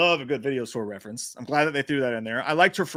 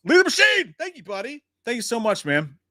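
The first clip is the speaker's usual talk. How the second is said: excited thanks; 9 semitones higher, 4 dB louder than usual. Real, not acted. Thanking a donor.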